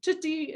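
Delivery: tootie with a t in place of each k; fronted cookie. Shown as a demonstration. Therapist modelling a toddler's tootie for parents. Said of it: "would also say".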